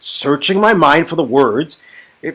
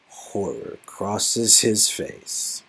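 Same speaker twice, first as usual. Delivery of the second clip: slow, soft narration; 9 semitones lower, 13 dB quieter, much slower than usual. A narrator's reading. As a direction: spell out the horror slowly.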